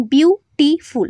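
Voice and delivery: বিউটিফুল split into three separate syllables, biu-ti-ful; broken into syllables. Shown as a demonstration. Teacher showing students a long word, বিউটিফুল broken syllable by syllable. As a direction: say বিউটিফুল in pieces, a short gap after each syllable. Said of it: "ভেঙে ভেঙে উচ্চারণ করতে হয়".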